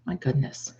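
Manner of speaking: ordinary talk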